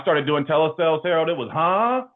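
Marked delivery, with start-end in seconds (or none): 1.5-2.0 high-pitched mock puzzlement; confused customer. Confused customer on a telesales call, high and baffled. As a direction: question it high, as if you didn't catch it.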